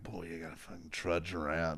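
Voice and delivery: soft tired gripe. Retold or imitated. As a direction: grumble it under your breath. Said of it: "you're like".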